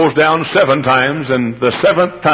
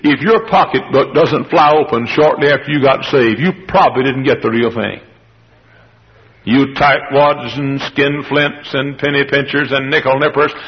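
normal talking voice

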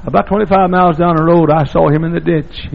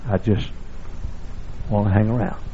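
normal talking voice

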